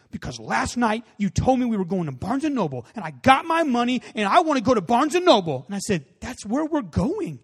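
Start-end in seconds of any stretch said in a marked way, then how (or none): 0.1-5.6 imitated upset kid, louder and higher as it builds; angry child. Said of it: "he's just so angry". 6.2-7.4 slow, higher-pitched parental insistence; exasperated parent. Retold or imitated. Parent answers the angry child.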